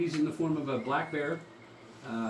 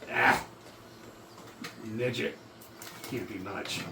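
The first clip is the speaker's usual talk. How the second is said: lightly gruff dismissive voice; a hint of a gruff creature; 2 semitones lower, 3 dB quieter than usual. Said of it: "another gruff voice say".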